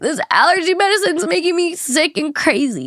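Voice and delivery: high, congested protest through tears; tearful. Real, not acted. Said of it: "Now I'm crying about saying thank you".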